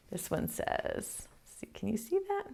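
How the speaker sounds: unvoiced, soft whisper. Real, not acted. Teacher murmuring while fixing the screen, whispered. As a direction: whisper it close to the mic. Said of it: "whispering to kids".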